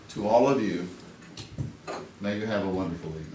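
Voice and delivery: ordinary talk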